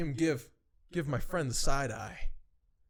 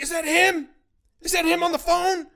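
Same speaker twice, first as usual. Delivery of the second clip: loud, high-pitched mock outburst; heated boss shouting; 11 dB louder than usual. Main boss bursting out about the phone call, heated. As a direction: bellow the questions as an angry boss. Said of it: "he was getting heated".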